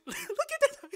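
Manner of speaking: very high, loud squeal through laughter; real, overwhelmed giggly shriek; giddy laughing. Real, not acted. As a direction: squeal it while cracking up. Thrilled as stream starts.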